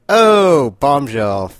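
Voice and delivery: loud, high drawn-out "oh" easing down; playful big reaction to a reveal; excited shock. Real, not acted. Fan reacting to the pregnancy news, excited.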